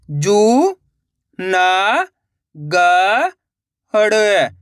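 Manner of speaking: long drawn-out syllables with pauses between. Shown as a demonstration. Teacher sounding out a word for learners, syllable by syllable. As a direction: sound out each syllable slowly and separately. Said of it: "एक एक अक्षर का उच्चारण करके".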